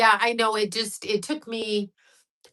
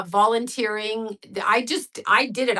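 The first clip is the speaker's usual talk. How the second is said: ordinary talk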